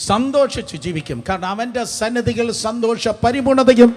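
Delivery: high, loud sermon climax; full-force preaching. Real, not acted. Preacher promising the congregation joy and Satan's tears, full-force proclamation.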